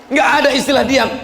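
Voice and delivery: fast, firm restatement with ada stressed. Real, not acted. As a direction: rattle it off again, ada stressed.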